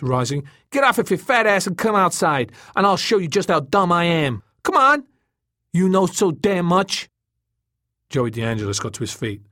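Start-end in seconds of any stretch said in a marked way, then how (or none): 0.7-7.1 loud, high-pitched furious dare; snapping angrily. A narrator's reading. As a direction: challenge him to a showdown, riled up. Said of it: "Tom snapped".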